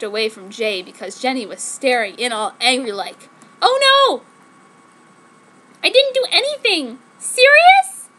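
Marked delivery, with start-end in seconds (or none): 3.6-8.1 put-on high, loud squeaky teen-girl voice; shrill mock-innocent denial; girly protest. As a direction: whine it like a hammed-up teen heroine.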